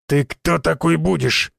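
slow, tense growled challenge; through clenched teeth. A narrator's reading. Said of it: "сквозь зубы процедил здоровяк". (медленно процедить сквозь зубы)

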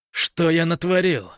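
high, loud self-reproach; yelling at himself. A narrator's reading. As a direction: shout it at yourself. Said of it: "проорал в свой адрес Гош".

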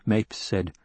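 usual speaking voice

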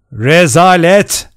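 slow, loud, outraged exclamation; shouting. A narrator's reading. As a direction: shout it in outrage, stretching the word. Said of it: "diye bağırdı". (öfkeyle bağırarak)

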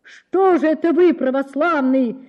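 a mild tearful yell; tearful cry. A narrator's reading. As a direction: shout at the crowd, near tears. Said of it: "кричит он слезливо".

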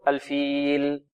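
الفيل slow with each ل clearly sounded; careful drawn-out الفيل; clear laam. Shown as a demonstration. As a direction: make the ل of الفيل distinct and unhurried. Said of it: "لا بد من إيضاحها".

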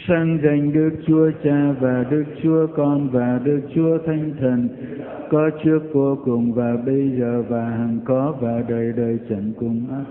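slow, half-sung recited prayer; slow liturgical chant of the Glory Be. Real, not acted. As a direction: intone the doxology slowly and devoutly.

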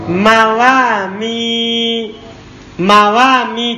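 "Mawami" said slowly, rising at the end; slow correction model. Shown as a demonstration. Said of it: "Bukan mauma". (koreksi: "Mawami" diucapkan pelan)

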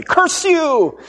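hammed-up melodrama cry, high and loud; old-timey curse. Retelling the old-timey curse, hammed-up loud shout. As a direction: bellow it like a silent-film villain. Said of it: "This is very old-timey".

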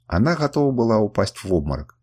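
usual speaking voice

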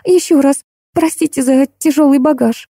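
put-on high girlish apology; tinkling little bell. A narrator's reading. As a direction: chime it out in a sweet high voice. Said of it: "колокольчиком прозвенела Машенька". (высокий голосок колокольчиком)

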